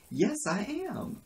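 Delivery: high, drawn-out retold taunt; gleeful, laughing admission. Woman laughs when asked if she is threatening, gleeful high admission. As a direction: stretch it high as a mocking confession. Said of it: "laughs and goes".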